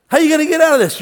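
high, loud, fast mocking question; taunting challenge. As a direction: throw it at them like a taunt.

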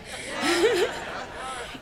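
brief amused chuckle. Real, not acted. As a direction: let out a light laugh. Laughs after own teasing.

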